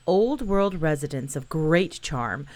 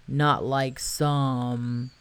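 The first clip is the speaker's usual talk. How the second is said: low, very slow sulky donkey voice; a sulky donkey grumbling; gloomy donkey; 4 semitones lower, much slower than usual. Acted. Eeyore sulky aside.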